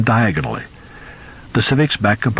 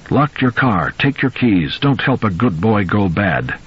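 stern public-service announcer voice; authoritative father figure; slower than usual. Acted. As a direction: lay down the rule like a grim father. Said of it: "the authoritative voice of that grim father figure".

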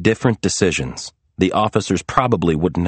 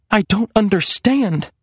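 high, tearful confusion; tearful trembling; 12 semitones higher than usual. A narrator's reading. Serena bewildered before her frightened family, tearful. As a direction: plead confusion through tears. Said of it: "her voice trembling with tears".

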